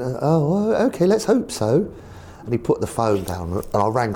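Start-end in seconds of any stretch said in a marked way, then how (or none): 0.0-1.9 high, doubtful posh voice; the posh chairman, unconvinced. Retold or imitated. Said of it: "He's very posh".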